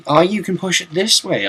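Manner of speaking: usual speaking voice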